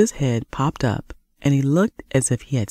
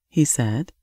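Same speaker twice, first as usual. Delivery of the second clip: usual speaking voice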